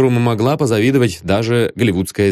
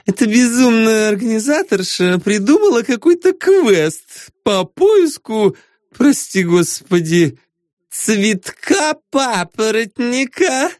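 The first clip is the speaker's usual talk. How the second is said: scornful diva; 12 semitones higher than usual. A narrator's reading. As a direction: sneer at the organizer. Lara mocking the organizer.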